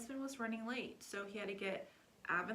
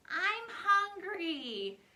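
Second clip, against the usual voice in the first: put-on high, loud, dragged-out child whine; whiny kid; 10 semitones higher, 10 dB louder, much slower than usual. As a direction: stretch it out like a hungry little girl. Daughter whining to dad.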